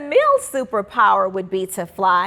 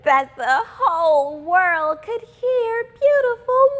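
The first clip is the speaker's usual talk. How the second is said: put-on high, bright little-girl voice; hopeful schoolgirl wishing aloud; young girl Simone; 12 semitones higher than usual. A narrator's reading. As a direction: read it as a dreamy young girl. Simone's singing wish.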